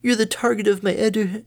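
high, bright-natured mother pony; doting mom, high and chirpy; cheery mother voice. Acted.

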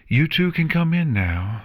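high, lightly projected call; summoning call, pitched well up. A narrator's reading. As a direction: call them in from the door. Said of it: "she called out".

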